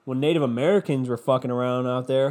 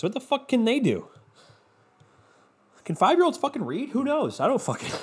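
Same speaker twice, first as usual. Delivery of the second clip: incredulous rant; 11 semitones higher than usual. Real, not acted. Incredulous rant about kids.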